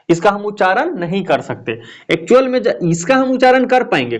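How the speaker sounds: ordinary talk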